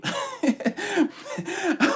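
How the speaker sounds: high-pitched burst of laughter; high giggle. Real, not acted. Laughing after push-ups joke.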